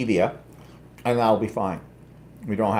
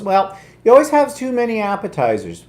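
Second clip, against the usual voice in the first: high, louder mocking quote of the sister; mimicking sister; 9 semitones higher, 5 dB louder than usual. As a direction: toss it off like a nagging sister-in-law. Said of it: "his sister goes".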